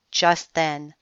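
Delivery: usual speaking voice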